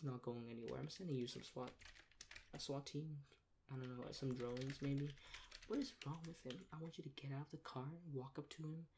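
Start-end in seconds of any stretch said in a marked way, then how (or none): none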